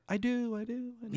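very high ghostly voice; a haunting, high-pitched answer; eerie ghost voice. Acted. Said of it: "And you hear".

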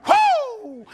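a high, loud whoop of delight; hollering whoo like a race fan; excited whoo. Retold or imitated. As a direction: let out a thrilled high whoop. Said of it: "just saw me and went".

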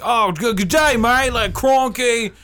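high, loud, hammed-up Aussie; bad Australian accent. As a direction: overdo a terrible Australian twang. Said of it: "your accent's so nice".

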